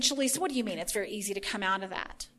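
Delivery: questioning listener. Retold or imitated. Listener puzzled by the preacher's claim, lightly imitated, quick and low. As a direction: voice a listener's question in a hurried undertone. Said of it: "you know, you're saying".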